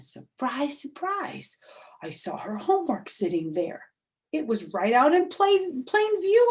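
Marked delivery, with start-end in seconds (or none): 0.0-1.6 mock surprise. Narrator surprise. 5.3-6.5 high, loud rising excitement; higher and louder with excitement; excited discovery. Acted.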